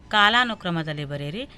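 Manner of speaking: ordinary talk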